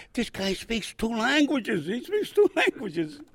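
slow, high amused retelling with a laugh; his buddy's amazed slow announcement, laughing through it. Retold or imitated. Marine buddy in church, tickled that he speaks two languages, slow and laughing.

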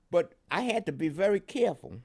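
usual speaking voice

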